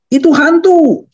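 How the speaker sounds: high-pitched cry of alarm, dropping at the end. Acted. Disciples on the water see a figure, crying out. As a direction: call it out high, as frightened disciples would. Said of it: "dan berseru".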